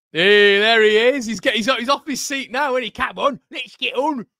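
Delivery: high, loud mock cheer; jeering shout. Real, not acted. Commentator mocking the rival manager off his seat, jeering shout. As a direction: crow it high, stretching the first words.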